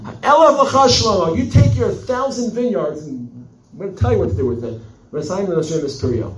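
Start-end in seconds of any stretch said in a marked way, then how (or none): 0.0-4.5 much higher, louder angry yell; furious shout. Acted. The woman screams at Shlomo over his thousand vineyards, furious. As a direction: scream it at him like a Knesset brawl. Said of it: "Whoa. Calm down".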